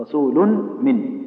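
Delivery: رسول من said very slowly, the tanween merged into the meem with a nasal hum. Shown as a demonstration. Teacher modelling idgham with ghunna for the student, slow nasal merge. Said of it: "إدغام بإيش".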